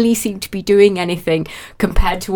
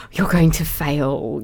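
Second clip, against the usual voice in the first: low, slow verdict of doom; ominous inner voice; 6 semitones lower, 4 dB quieter, slower than usual. Acted. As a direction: draw it out low, like a mock warning. Voicing the dooming inner verdict.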